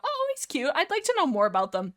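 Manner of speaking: light high-pitched fondness; fond cooing. Acted. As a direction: coo it high up like seeing something cute. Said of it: "just a positive feeling of".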